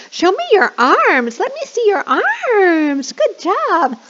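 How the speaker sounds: hammed-up high praise for kids; enthusiastic teacher. Shown as a demonstration. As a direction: get the kids moving. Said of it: "And then you can say".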